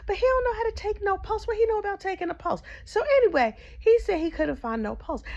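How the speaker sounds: high, disbelieving rant; incredulous. Real, not acted. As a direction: squeal it in disbelief. Incredulous outraged commentary.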